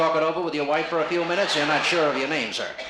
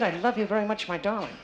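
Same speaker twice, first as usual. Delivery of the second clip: higher-pitched echo of a love line; higher mock-romantic imitation; imitating the volunteer; 7 semitones higher than usual. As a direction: repeat his love line in a raised, teasing pitch. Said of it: "he said that pretty good".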